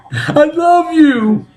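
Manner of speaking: very high, drawn-out mock declaration; strained squeal. Man reassuring his partner mid-act, slow high squeal.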